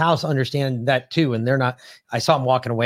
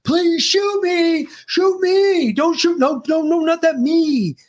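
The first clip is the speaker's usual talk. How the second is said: high, slow, hammed-up yelling plea; mock scream; much slower than usual. Co-host mocking a door-to-door vaxxer, hammed-up high scream. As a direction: screech it way up high and drag it out. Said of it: "That's like screaming, like, at the top of your lungs".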